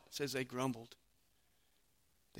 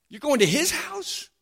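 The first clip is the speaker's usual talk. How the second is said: high, loud incredulous question; you can't believe he picked him; shocked disbelief; 11 semitones higher, 5 dB louder than usual. Acted. Crowd asks Jesus, openly shocked and indignant.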